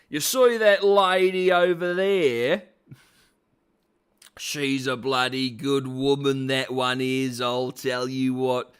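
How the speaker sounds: broad, hammed-up Aussie bloke; Australian accent. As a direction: lay the Australian accent on thick. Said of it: "oh, he's Australian".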